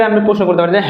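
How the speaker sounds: ordinary talk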